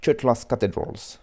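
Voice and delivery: slow, attempted American accent; American English accent. Shown as a demonstration. Radio host trying the English title, self-consciously, in an American accent. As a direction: say it slowly with a put-on American accent. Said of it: "si je vais le dire avec l'accent".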